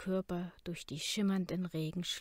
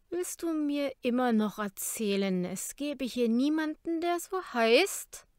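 rising mocking challenge, slowing at end; taunting; 5 semitones higher, 7 dB louder than usual. A narrator's reading. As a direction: needle him, louder and higher. Kara taunts Jacob.

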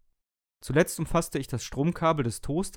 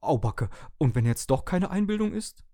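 high, agitated kettle voice; excited; 6 semitones higher, 4 dB louder than usual. Acted. Kettle frets to the others, excited and high. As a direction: bubble over with worry. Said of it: "Der Wasserkocher blubberte aufgeregt".

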